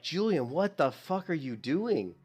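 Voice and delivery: slow, high, drawn-out self-scolding; mock yelling at self. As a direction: stretch it out like telling yourself off. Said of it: "I just was yelling at myself".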